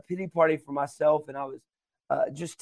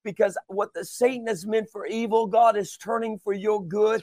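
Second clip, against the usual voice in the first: higher preaching voice with punched phrases; impassioned preaching; 5 semitones higher than usual. Acted. Preacher urging listeners not to give up, impassioned.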